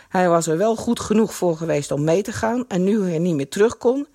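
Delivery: ordinary delivery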